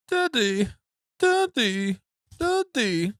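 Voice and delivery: high-pitched imitation of a small child; little son calling. Son calls his father at the restaurant table, high childlike plea. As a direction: call for dad like a queasy toddler. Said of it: "All of a sudden, Frank's like".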